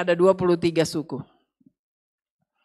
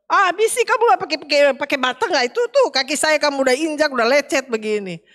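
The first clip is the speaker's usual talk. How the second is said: higher, fast, indignant scolding; fast, high-pitched mock outrage; mock angry complaint; 5 semitones higher, faster than usual. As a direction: rattle off a complaint.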